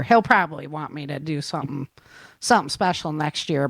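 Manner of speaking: ordinary delivery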